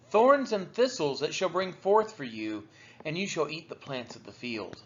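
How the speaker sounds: normal talking voice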